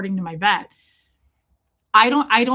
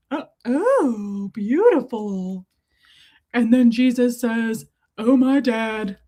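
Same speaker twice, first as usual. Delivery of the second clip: put-on mock-reverent storyteller voice; cooing, drawn-out exclamations of adoration; pet-talk storytelling; 5 dB louder than usual. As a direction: proclaim a doting Bible tale to a dog. Repeats mock Bible tale to dog.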